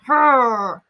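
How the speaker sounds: loud, furious huff; angry huff. Acted. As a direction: huff it through clenched teeth. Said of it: "He's like".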